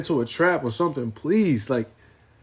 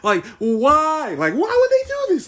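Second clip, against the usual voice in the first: hammed-up wail, much higher; mock anguished cry; 10 semitones higher than usual. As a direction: cry out to the sky in fake grief. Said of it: "I'm over here getting loud".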